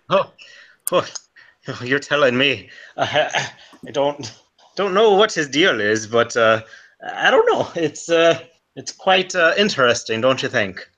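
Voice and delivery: put-on wobbly Irish accent, slipping in and out; a shaky stab at an Irishman. Irish adventurer chatting to Remy, wobbly Irish accent. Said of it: "I don't even know if I'm doing Irish".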